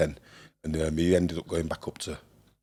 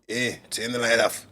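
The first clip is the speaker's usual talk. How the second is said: higher-pitched mock Scottish accent; attempted Scottish accent; 4 semitones higher than usual. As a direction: try a rough Scottish accent. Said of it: "attempt at a Scottish accent".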